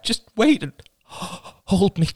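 high, slow plea broken by a gasp; crying out, gasping. A narrator's reading. Man mid-attack begging his girlfriend to hold him, gasping. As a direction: beg haltingly, fighting for breath. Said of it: "he cried out".

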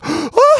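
loud, high mock gasp; shocked gasp. Imitating sister tasting hot sauce, exaggerated gasp.

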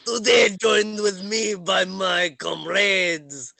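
put-on high, slow French-accented announcement. Game master introduces his fellow players, slow French accent. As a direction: present them grandly in a put-on French accent. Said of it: "going to drop the accent".

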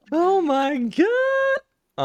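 high, slow, drawn-out shock; shocked falsetto. Real, not acted. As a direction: gasp it out in disbelief.

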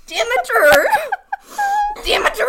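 high, loud, laughing cry of "Demeter"; mock yelling. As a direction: belt out "Demeter" in hammed-up outrage. Shouting the mangled name in mockery.

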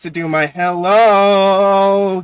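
loud, slow, eager delivery; gleeful anticipation; excited. Real, not acted. Excited about his gag.

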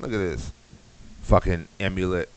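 usual speaking voice

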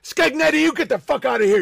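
mock cop throwing a partner out; other cop yelling. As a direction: bark at the partner to leave, fast. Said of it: "the other cop comes in".

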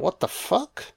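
lightly voiced startled woman; mock shocked victim. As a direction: play the woman's startled what-the-fuck, high and small. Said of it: "She's like".

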